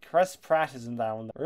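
ordinary delivery

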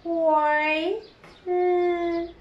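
Oi and Mm held long, each on its own; sounded out. Shown as a demonstration. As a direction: stretch Oi, pause, then hum Mm. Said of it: "أوي".

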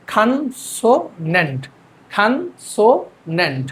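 "খানসোনেন্ট" very slow as khan, so, nent; American pronunciation, syllabified. Shown as a demonstration. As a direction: break "খানসোনেন্ট" into three beats, open a first. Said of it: "American pronunciation শিখছি". ("খানসোনেন্ট" American উচ্চারণে, ভেঙে ধীরে)

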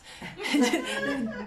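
short laugh at own wordplay; amused laugh. Real, not acted. Preacher joking with the class about fications, amused laugh. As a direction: let out a real chuckle.

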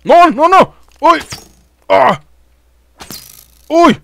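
high, loud yelps of alarm; panicked yelps. Real, not acted. Player suddenly under attack in the game, panicked yelping. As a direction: yelp as danger hits out of nowhere.

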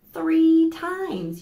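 put-on rally the kids to count along; excited. Librarian excitedly counts the hippo yawns for children.

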